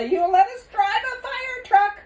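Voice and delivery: high, loud and eager; excited child. Acted. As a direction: beg excitedly for the ride. Sheila pleading, high and loud.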